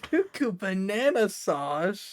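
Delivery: drawn-out, laughing disbelief; incredulous laughing echo. Real, not acted. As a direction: repeat it back in disbelief, cracking up. Laughing disbelief at odd phrase.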